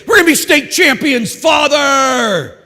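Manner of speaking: put-on high, loud shout of eager boys; boys shouting back. Boys answering their priest with a high, loud eager shout. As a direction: yell it like a team chanting its goal.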